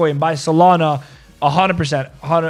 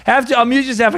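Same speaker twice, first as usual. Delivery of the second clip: calling out; 6 semitones higher than usual. Real, not acted. Calling to muted guest on stream.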